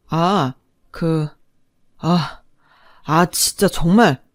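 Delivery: slow, halting, lost for words; splutter, searching for anything to say; flustered stammer. Acted. Cornered husband stammering, out of arguments.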